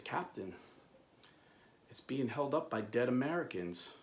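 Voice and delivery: low, soft, halting answer; hushed report. Retold or imitated. Sailor reporting to the captain, hushed. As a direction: break the grim news quietly, pausing after Captain.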